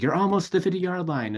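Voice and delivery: high-pitched urging; encouraging coach. Retold or imitated. Retelling a film coach urging a player to the line, urgent encouragement. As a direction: imitate a coach pushing his player onward.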